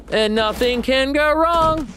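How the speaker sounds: very high, loud falsetto lament; high falsetto wail. Shown as a demonstration. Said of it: "make that an official sound alert".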